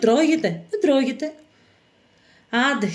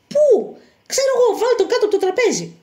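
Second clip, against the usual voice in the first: high, frantic voice; flustered panic; 10 semitones higher than usual. Acted. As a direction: scramble for a hiding place. Panicked hiding of the fugitive.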